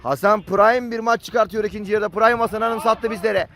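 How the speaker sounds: usual speaking voice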